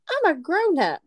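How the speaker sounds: high-pitched, offended retort; mock indignant. Wife answering her husband's excuse, mock indignant.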